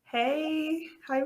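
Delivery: normal talking voice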